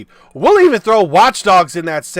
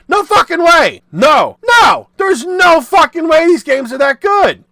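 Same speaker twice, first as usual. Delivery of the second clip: the same line shouted, louder and higher; 7 semitones higher, 4 dB louder than usual. Real, not acted. Insisting to viewers these games aren't good, shouting. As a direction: blast it out, no way.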